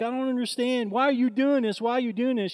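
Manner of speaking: high-pitched retold distress; mock bawling. Clerk questions the woman on her head, high mock wail. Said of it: "She's bawling".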